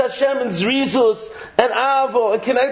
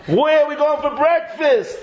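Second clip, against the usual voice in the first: high-pitched, hammed-up eagerness; mock excitement; 6 semitones higher than usual. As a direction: ask like breakfast is the big event. Acted excited breakfast planner.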